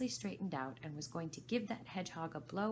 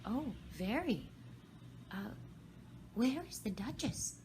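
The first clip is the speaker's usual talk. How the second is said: Alice, curious; 6 semitones higher than usual. Acted. Alice chatting nervously.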